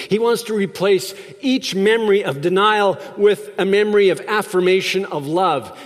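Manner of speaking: ordinary talk, nothing marked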